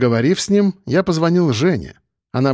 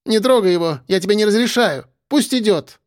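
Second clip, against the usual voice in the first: high-pitched tipsy command; imperious protest; 7 semitones higher than usual. Acted. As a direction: forbid him, high and insistent. Tipsy fiancée forbids a fight. (капризно-повелительно)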